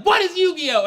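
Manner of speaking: high, loud demand; baffled shout. Acted. Parents demanding their son explain, baffled shout. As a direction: bellow the question in confusion.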